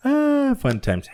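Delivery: high, slow Ah fun times; mock nostalgic. Host joking about old drinking times, high and slow. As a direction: sigh it out in a lofty mock tone. Wistful joke after laughing.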